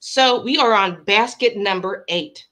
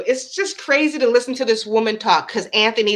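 ordinary talk

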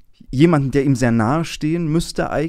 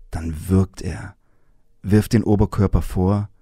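story reading; 3 semitones lower than usual. Acted. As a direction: read it aloud with weight and pauses. Reads a novel passage aloud.

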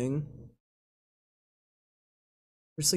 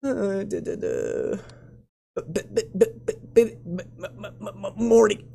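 exaggerated nervous stammer, pitched higher; Morty; 5 semitones higher than usual. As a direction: stutter repeatedly on the first sound before getting the word out. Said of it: "I sound like freaking Rick and Morty".

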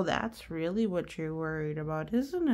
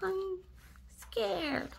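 higher, softer small pigeon voice; scared pigeon; 10 semitones higher, 6 dB quieter than usual. Acted. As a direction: say it small and frightened.

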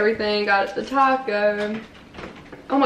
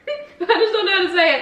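laughing; 8 semitones higher than usual. Real, not acted. Laughing at own mispronunciation.

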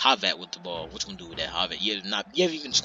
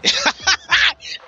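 high loud laugh; burst of laughter; 9 dB louder than usual. Real, not acted. Commentator laughing at disappointing team. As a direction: crack up at the team.

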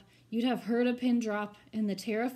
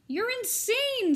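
much higher, slow, spitting scorn; spat; 12 semitones higher, 7 dB louder, slower than usual. Acted. The mayor scornfully spitting at Herbert, high and slow. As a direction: spit it at him in disgust. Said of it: "spat the mayor".

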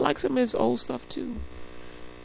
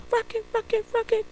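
mock raunchy singer; 14 semitones higher than usual. Mocking a raunchy song.

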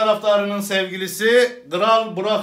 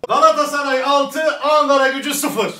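louder, higher mock announcer; loud score announcement; 8 semitones higher, 7 dB louder than usual. Comic announces the score in a loud announcer voice. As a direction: blare the score out.

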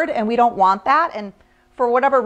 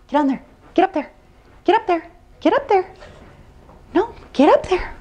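calling a dog; 8 semitones higher than usual. Real, not acted. Urging dog onto board.

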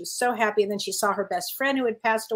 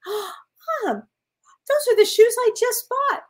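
higher and louder, a retold amazed cry; surprised; 12 semitones higher, 5 dB louder than usual. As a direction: exclaim in astonished recognition. Retelling surprise in the dream.

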